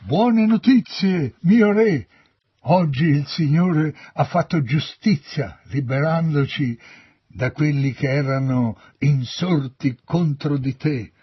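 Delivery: loud, high herald's cry; jubilant proclamation. A narrator's reading. Ethiopian soldier announces victory to the king, proclaiming.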